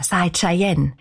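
plain reading voice

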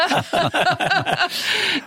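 a bright high burst of laughter, falling away; real laugh. Real, not acted. Laughing after warning the host about joual. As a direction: laugh at your own joke, high and free.

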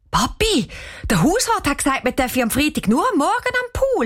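high-pitched whining protest to Dad; indignant complaint. A narrator's reading. Girl complains indignantly to her father. (empörte, hohe Beschwerde)

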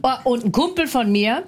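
ordinary talk

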